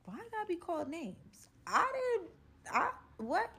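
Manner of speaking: high, offended squeak; mock offended. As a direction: play the insulted girl, pitch up. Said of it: "And I'm like".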